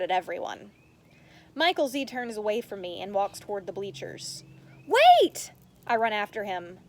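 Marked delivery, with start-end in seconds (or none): 4.9-5.5 loud, very high call. Acted. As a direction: call after him as he leaves. Calling after friend walking away.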